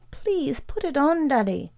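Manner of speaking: put-on high-pitched little boy's plea; small high voice, pleading; pleading child. A narrator's reading. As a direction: beg Daddy as a frightened child. Boy pleads for the light.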